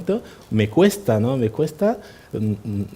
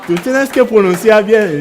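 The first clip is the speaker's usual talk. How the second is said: higher, louder mock reproach in the grocer's voice; mock scolding; 8 semitones higher, 9 dB louder than usual. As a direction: scold him to pronounce properly. Grocer scolds customer on pronunciation.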